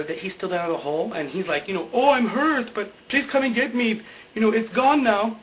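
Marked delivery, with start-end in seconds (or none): 1.9-5.4 high, loud retold plea; mock cry for help. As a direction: call up from the hole, hammed-up. Acts out injured man's plea.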